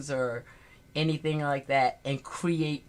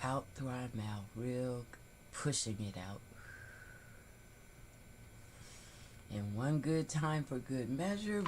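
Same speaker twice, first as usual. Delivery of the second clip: guided breathing; 9 dB quieter than usual. Acted. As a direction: talk them gently through each breath. Leads a breathing exercise.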